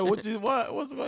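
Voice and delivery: high loud stammered question; flustered, rushed confusion; alarmed. Retold or imitated. Learning the joke turned real, high alarmed stammer. Panicked reply to brother.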